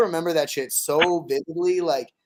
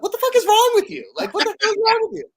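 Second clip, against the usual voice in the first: exasperated shout; 5 dB louder than usual. Retold or imitated. Exasperated retort to brother's mother.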